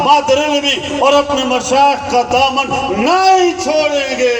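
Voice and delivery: high-pitched, ringing oratory; fervent pledge preaching. Acted. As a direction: declare a solemn vow for the whole gathering, stretching key words. Leads congregation in pledge. (بلند سُر میں پُرجوش عہد)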